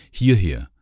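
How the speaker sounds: loud call across the room. Acted. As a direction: call the group over, projecting. Guide calls visitors over. (laut herübergerufen)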